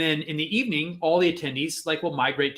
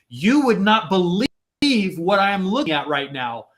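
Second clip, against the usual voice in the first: animated show-off; 4 semitones higher, 6 dB louder than usual. Retold or imitated. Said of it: "and he's like".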